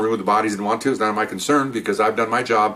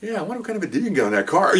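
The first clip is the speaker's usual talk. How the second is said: eager recruit; 10 semitones higher, faster than usual. Retold or imitated.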